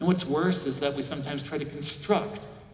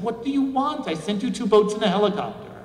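exasperated; 8 semitones higher, 6 dB louder than usual. Retold or imitated. God exasperated with drowned man.

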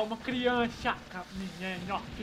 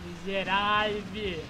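drawn out; 3 semitones lower, 3 dB louder, much slower than usual. Acted. Cursing an enemy in game.

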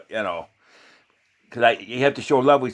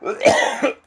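real choking cough; sudden involuntary choking fit. Real, not acted. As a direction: cough as if something caught in the throat. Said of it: "Excuse me, I'm choking".

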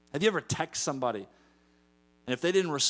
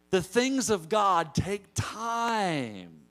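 slow preaching emphasis; 6 semitones higher, much slower than usual. Real, not acted.

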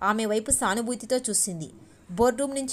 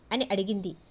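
usual speaking voice

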